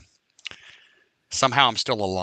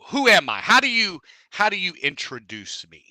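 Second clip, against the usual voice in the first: mock demanding; 7 semitones higher, 8 dB louder than usual. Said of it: "I go".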